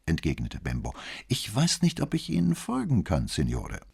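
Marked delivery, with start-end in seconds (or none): 1.3-3.8 high, lightly puzzled politeness, dropping on the last word; mildly perplexed, courteous tone; smiling bafflement. A narrator's reading. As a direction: feign being at a loss, with a smile. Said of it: "mit einem Lächeln, das seine Ratlosigkeit unterstreichen".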